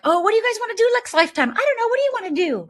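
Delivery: high, quick, breezy angel chatter; playful angels chatting. Retold or imitated. Angels in heaven planning the next life, high and breezy. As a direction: chirp it like carefree souls planning. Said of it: "And it's like".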